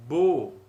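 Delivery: Bo said loud and clear; syllable demo. Shown as a demonstration. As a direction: call out Bo. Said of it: "como você leria?".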